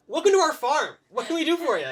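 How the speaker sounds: put-on high, loud eager boy; excited kid voice. Young son greets the strangers, high and eager. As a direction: bounce with a young lad's excitement. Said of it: "He goes".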